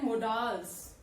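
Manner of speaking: "modals" with a full vowel in the second syllable; common mispronunciation. Retold or imitated. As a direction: say "modals" as mo-DAALS, the mistaken way. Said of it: "people tend to pronounce it as".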